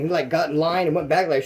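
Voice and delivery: ordinary talk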